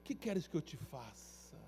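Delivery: Jesus gently asking the blind man what he wants; soft and gentle. Acted. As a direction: ask him kindly, almost hushed.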